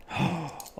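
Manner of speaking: sharp gasp of delight; surprised gasp. Real, not acted. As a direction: gasp as it finally works. Part comes out successfully.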